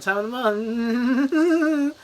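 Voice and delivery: rising mock alarm. Mimes dread of that time.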